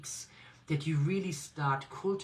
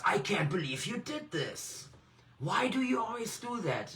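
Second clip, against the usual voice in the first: higher, scolding reproach to yourself; harsh self-scolding; 8 semitones higher than usual. Shown as a demonstration. Coach showing rough self-talk after a mistake, mildly harsh.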